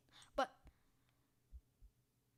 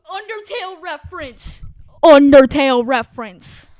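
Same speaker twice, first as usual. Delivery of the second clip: very loud gleeful shout; excited shout; 3 semitones higher, 30 dB louder than usual. Real, not acted. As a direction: scream it in delight. Recognising a reference.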